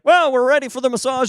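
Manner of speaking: high, quick imitation of a therapist bursting in; mock cheery announcement. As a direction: pitch it way up and hurry it out. Therapist walks in too early.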